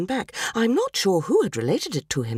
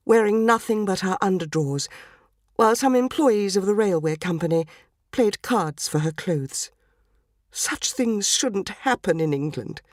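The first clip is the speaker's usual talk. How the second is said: ordinary delivery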